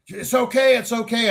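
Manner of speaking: high, fussing bystander voice; imitated reassurance. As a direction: mimic people rushing over to reassure. Bystanders rush to reassure him.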